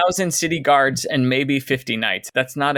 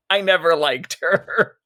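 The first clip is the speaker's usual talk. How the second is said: chuckling through the words; laughing; 4 semitones higher than usual. Retold or imitated. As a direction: laugh while saying it. Laughing through Flint quote.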